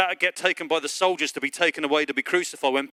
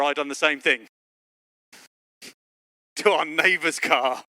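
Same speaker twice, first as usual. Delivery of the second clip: usual speaking voice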